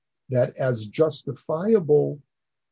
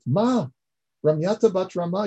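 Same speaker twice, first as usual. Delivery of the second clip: "Ma, Ramyata bat Rama" cried out much higher and louder; amazed outcry; 6 semitones higher, 3 dB louder than usual. Retold or imitated. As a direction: burst out in shocked disbelief. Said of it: "Yaakov says in amazement".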